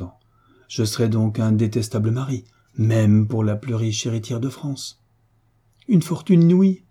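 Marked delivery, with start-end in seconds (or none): 5.9-6.8 high, loud dazed disbelief; stunned repetition. A narrator's reading. Mother stunned by her son's refusal, loud dazed disbelief. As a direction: repeat it as if thunderstruck. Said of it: "répéta madame de Senneterre, comme hébétée".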